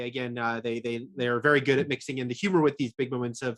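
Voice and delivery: ordinary delivery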